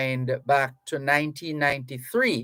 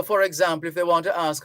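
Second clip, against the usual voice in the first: normal talking voice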